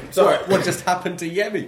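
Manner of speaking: high, loud laughter over the question; laughing. Real, not acted. Host laughing at friend collapsing on the floor. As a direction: crack up mid-sentence.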